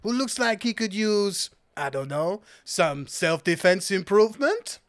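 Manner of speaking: flirtatious, hammy French shopkeeper; put-on French accent. Seller admiring customer's big muscles, hammy French accent. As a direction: flatter the customer in effusive French-accented English. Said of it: "I will do this accent".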